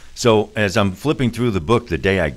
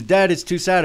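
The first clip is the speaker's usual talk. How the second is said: daughter's voice; 11 semitones higher than usual. Retold or imitated. Daughter quoted by father.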